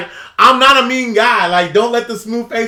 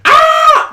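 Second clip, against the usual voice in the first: sudden high mock shriek, much louder; retold scream; 7 dB louder than usual. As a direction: let out a short imitated scream.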